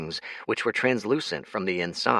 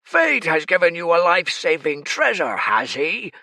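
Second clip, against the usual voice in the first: loud, higher villain sneer; demon king voice; 8 semitones higher, 6 dB louder than usual. Acted. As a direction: sneer at the enemy.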